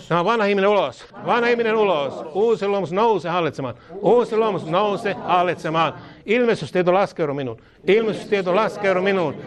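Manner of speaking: declaring prayer, each line said twice; fervent prayer. Acted. Preacher leading the church in fervent prayer. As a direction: pray it out with authority.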